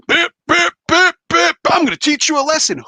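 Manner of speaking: high, loud hammed-up bully voice; mock tough guy. Mocking a hothead who wants to teach a kid a lesson, high and loud. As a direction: sneer it like a cocky brawler.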